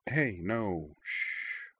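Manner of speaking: soft hush, ending in a whispered shh; gentle, quiet comforting; soothing. A narrator's reading. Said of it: "said Corwin soothingly".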